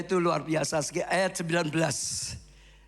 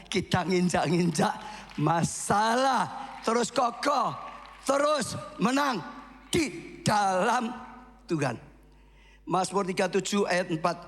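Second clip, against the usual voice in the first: ordinary talk, nothing marked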